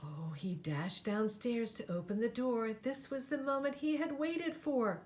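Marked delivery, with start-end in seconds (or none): none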